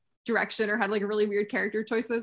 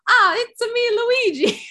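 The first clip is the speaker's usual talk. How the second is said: mock Italian Luigi accent, higher and louder; fake Italian accent; 11 semitones higher, 5 dB louder than usual. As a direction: ham up a cartoon Italian accent. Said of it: "He sounds like he's doing the".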